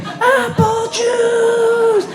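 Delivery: put-on high, drawn-out whiny kid voice; kid's demand. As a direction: stretch it out like a whining little boy. Kid demanding apple juice at 3am.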